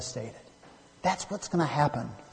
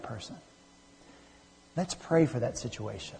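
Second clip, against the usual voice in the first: gentle invitation; 6 dB quieter than usual. Acted. Churchgoer in a sanctuary corner inviting another to pray, gentle invitation. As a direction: quietly coax someone to join you.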